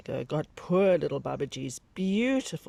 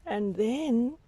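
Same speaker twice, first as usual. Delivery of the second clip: delighted wonder; 9 semitones higher than usual. A narrator's reading. Narrator marvels at the outfit.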